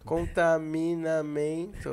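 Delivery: contaminamento dragged out slow; drawn-out wrong word. Pretend. Typing the wrong word, reading it slowly aloud. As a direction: stretch contaminamento syllable by syllable. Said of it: "repeating the wrong word".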